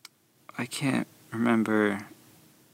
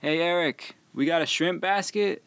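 calling out; 7 semitones higher than usual. Retold or imitated. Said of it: "I just shouted over to the other guy".

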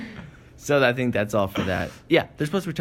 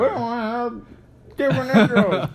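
high put-on audience voice; voice of the people; 10 semitones higher, 3 dB louder than usual. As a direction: answer as the listeners, laughing through it. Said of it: "Ask the people".

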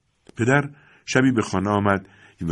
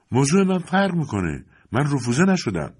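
indignant protest; 7 semitones higher than usual. Acted. Boy protests rejection to manager.

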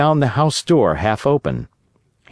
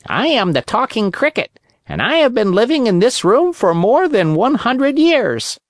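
high, lightly voiced old cricket; talking cricket voice; 11 semitones higher than usual. Old cricket introducing himself to the puppet, pitched-up creature voice. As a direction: speak as a wise little cricket, pitched up.

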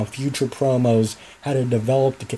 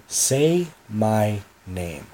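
slow menace; 4 semitones lower, much slower than usual. Acted. Villain demands his name.

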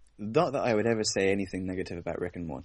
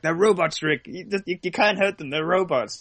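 higher, louder mock Rick insisting; Rick impression; 10 semitones higher, 4 dB louder than usual. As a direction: brush off the worry, hammed-up. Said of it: "It's like".

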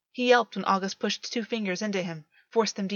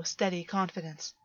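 ordinary delivery, nothing marked

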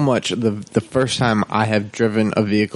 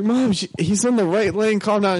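higher, urgent plea to calm down; exasperated pleading; 9 semitones higher than usual. Retold or imitated. Son telling his panicking mom to calm down, higher urgent plea. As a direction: talk mom down in a pitched-up rush. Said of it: "I was like".

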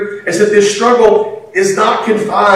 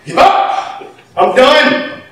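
mock exasperation; 7 semitones higher, much slower than usual. Parent giving up in exasperation.

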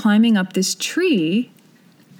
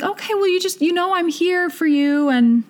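concerned mom; 6 semitones higher than usual. Retold or imitated. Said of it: "she's like".

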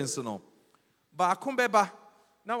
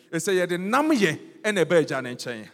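louder, nam stressed hard; emphatic repeat; 3 semitones higher, 7 dB louder than usual. Shown as a demonstration. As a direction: hammer nam home to the congregation. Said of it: "climactic repeat of phrase".

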